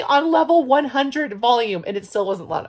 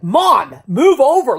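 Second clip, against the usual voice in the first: louder imitation of son's demand; mock freaking-out son; 3 semitones higher, 7 dB louder, slower than usual. Imitating son telling mom to move. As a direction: son ordering mom over. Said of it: "he's freaking out".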